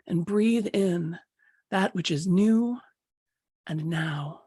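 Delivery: soothing meditation guide easing the group in; guided meditation. Acted. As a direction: talk them gently into the moment. Guided relaxation before breathing.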